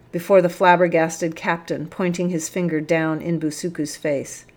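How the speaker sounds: ordinary delivery, nothing marked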